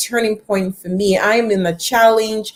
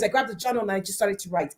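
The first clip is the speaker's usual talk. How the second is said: ordinary talk, nothing marked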